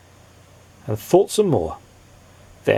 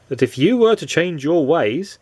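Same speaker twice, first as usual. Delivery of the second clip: ordinary delivery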